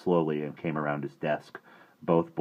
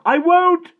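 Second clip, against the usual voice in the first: high choked cry; 14 dB louder than usual. A narrator's reading. Desperate boy defying the headmaster, choked cry. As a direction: choke it out near tears. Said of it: "Omri choked out".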